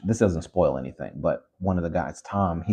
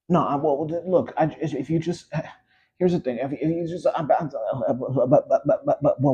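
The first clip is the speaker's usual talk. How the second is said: high, flustered stammering parody, much higher; mock stuttering; 8 semitones higher than usual. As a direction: sputter helplessly, dodging the question. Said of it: "what are we stuttering for?".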